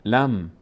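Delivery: "لم" said "lam", ending on held M; لام joined to میم. Shown as a demonstration. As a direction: blend L and short a into a resting M. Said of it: "لام میچسبه به میم". («لَم» با میمِ ساکن)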